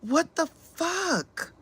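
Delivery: slow, stunned what the fuck; drawn out in disbelief; disbelieving. Real, not acted.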